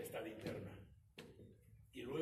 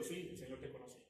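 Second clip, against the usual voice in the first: ordinary talk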